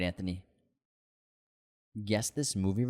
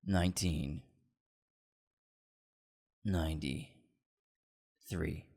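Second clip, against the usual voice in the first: trailer voice; 5 semitones lower, 5 dB quieter, much slower than usual. Pretend. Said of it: "stuck in my trailer voice".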